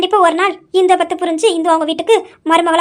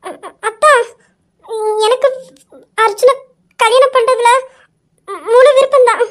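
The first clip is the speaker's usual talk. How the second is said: halting, stammered confession, pitched up; தயக்கமான ஒப்புதல்; 5 semitones higher, 3 dB louder than usual. Acted. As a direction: stammer shyly, long pauses, building to a louder finish. Shy daughter finally admits her willingness. (தயங்கித் திக்கி, உயர்ந்த குரலில் சம்மதம்)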